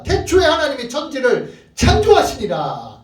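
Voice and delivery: ringing pulpit announcement; forceful proclamation. Acted. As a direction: proclaim it boldly, drawing out the final word. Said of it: "일방적으로 선포하고 선언하고".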